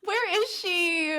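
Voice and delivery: high, drawn-out mock cry; playful wail. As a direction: wail it slowly, high, as a joke. Mock-distressed wondering about a forgotten contestant.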